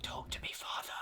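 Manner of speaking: hushed voiceless plea; whisper. Retold or imitated. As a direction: whisper it to the ground. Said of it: "is all like".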